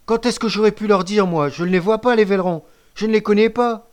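high, loud pleading denial; frantic protest. A narrator's reading. Accused boy pleading he knows no enemy, frantic protest. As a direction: argue desperately, voice up high throughout. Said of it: "protestait de nouveau Bacayé".

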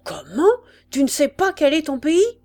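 loud, rising disbelief; incredulous. A narrator's reading. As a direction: question her in loud astonishment. Sergeant astonished by her not knowing. (incrédulité bruyante)

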